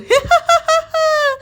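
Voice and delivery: high, loud cackle; evil witch cackle. Acted. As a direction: laugh in triumph like a witch. Witch gloats. (चुड़ैल की ऊँची, ज़ोरदार हँसी)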